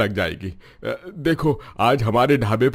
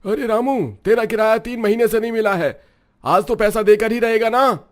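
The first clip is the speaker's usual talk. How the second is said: high, loud, menacing demand; bullying shout; 12 semitones higher, 5 dB louder than usual. Acted. Landlord Ramesh threatens Ramu over unpaid rent, shouting high and loud. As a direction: bark it at him like a thug landlord.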